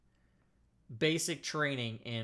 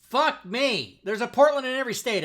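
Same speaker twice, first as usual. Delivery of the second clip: loud, high exclamation; amazed, pitch and volume way up; real astonishment; 8 semitones higher, 9 dB louder than usual. Real, not acted. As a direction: announce a stunning discovery to everybody. Astonished, announces result to viewers.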